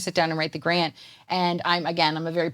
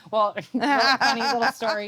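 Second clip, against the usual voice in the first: laughing; 4 semitones higher than usual. Real, not acted. Starts telling funny story.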